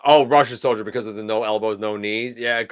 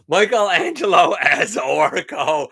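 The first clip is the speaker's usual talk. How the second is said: laughing; 8 semitones higher, 7 dB louder, slower than usual. Real, not acted. Repeats the suggestion, delighted.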